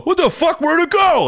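high mock-baffled outcry; confused fans. Viewers discovering the channel is gone, high baffled outcry. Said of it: "The fifteen thousand people on the channel go".

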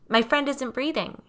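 a panicked caller, lightly acted; shouting into phone. A narrator's reading. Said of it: "he shouts into the phone".

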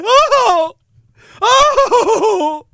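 high, loud hammed-up shriek; mock panicked cry. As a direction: yelp like a fleeing businessman.

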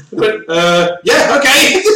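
usual speaking voice

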